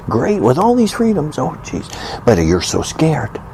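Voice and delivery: normal talking voice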